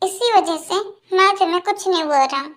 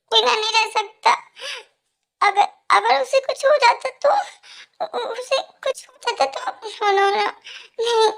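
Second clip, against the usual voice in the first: sobbing; 3 semitones higher than usual. Acted. Crying breakdown over Tej.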